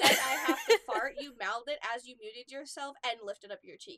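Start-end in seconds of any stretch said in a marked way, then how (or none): 0.0-1.1 repeated louder through laughter; laughing retold quote. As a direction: say it again, laughing this time. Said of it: "you said".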